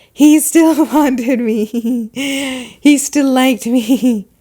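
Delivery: mocking giggly imitation. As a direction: giggle through it, stretching each word mockingly. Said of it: "What are you giggling about?".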